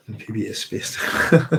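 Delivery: half-laughing as she reads it out. Real, not acted. Reading chat name with a laugh.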